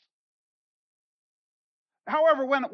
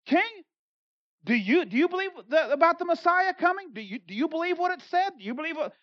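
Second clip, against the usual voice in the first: pressing the king; 7 semitones higher than usual. Retold or imitated. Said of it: "put him on the spot".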